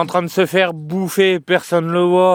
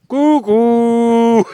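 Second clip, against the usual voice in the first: playful greeting; 7 semitones higher, 6 dB louder than usual. Real, not acted.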